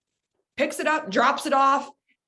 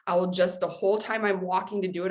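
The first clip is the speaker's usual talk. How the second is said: usual speaking voice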